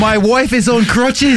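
slow, high British pleading; mock British accent. Co-host as the driver excusing his wife, mock British accent. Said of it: "old guy with a British accent".

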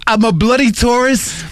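high, hammed-up British tourist; mock British accent. Imitating the British driver for the co-host, mock British accent. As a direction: put on a flustered British accent. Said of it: "old guy with a British accent".

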